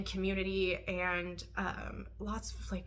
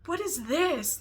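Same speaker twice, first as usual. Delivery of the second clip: higher-pitched baffled reaction; confused disbelief; 6 semitones higher, slower than usual. Retold or imitated. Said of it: "I was so confused by it".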